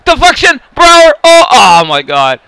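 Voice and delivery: high, loud shouts rising in excitement; excited yelling. Real, not acted. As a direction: yell each name as the play unfolds. Scoring chance in hockey game.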